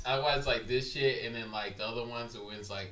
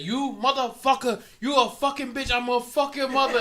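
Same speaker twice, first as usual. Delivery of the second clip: high, louder imitation of an angry scammer; mock angry scammer; 10 semitones higher, 4 dB louder than usual. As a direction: spit it back like the cursing scammer, raised up. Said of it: "the Indian guy gets mad".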